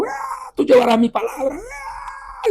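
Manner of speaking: high, strained shouting cry of the voice in his ear; a piercing shouted cry, the voice breaking into a scream; distorted shout. Retold or imitated. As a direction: cry out the command like a blasting, distorted voice. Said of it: "se distorsionó en mi oído y gritó".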